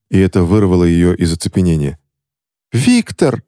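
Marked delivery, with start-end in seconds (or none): 2.7-3.4 shouting. Acted. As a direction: scream his name in alarm. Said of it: "закричала Кристина".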